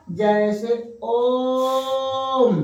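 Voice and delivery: ओम stretched very slowly over three beats; drawn-out Om. Shown as a demonstration. Teacher demonstrating pluta length to students, very slow ओम. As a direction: hold the ओम vowel, very slow. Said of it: "इसमें तीन मात्रा का समय लग रहा है".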